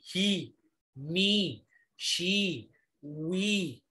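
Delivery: ही, मी, शी, वी each stretched on a long "ee"; slow, held ee in ही, मी, शी, वी; long ई demo. Shown as a demonstration. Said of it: "ई स्वर का दीर्घ ई".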